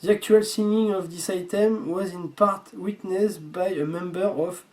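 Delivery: halting English reading in a French accent. Real, not acted. Reading the signing note to viewers, French-accented English. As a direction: sound out the English with French vowels. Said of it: "désolé pour mon accent anglais".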